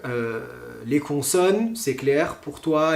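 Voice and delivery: ordinary talk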